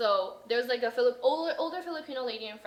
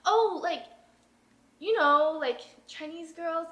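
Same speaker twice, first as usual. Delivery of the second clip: imitating a flustered, higher-pitched backpedal; halting, raised-pitch mock fluster; 5 semitones higher, 5 dB louder, slower than usual. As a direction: stammer it out like someone caught out. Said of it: "he was so flustered".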